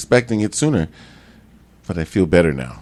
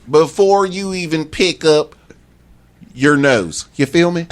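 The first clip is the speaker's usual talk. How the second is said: Florida cracker voice; 6 semitones higher than usual. Pretend. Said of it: "Florida cracker voice".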